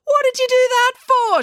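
loud, high indignant yell; furious high-pitched question; outraged demand. A narrator's reading. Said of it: "she demanded".

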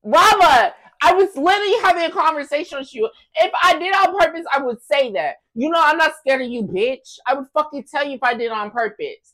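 high, loud indignant protest; heated self-defence. Real, not acted. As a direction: argue back hotly, voice climbing. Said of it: "Why are you raising your voice?".

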